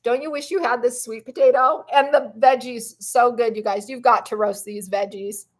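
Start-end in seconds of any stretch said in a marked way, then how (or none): none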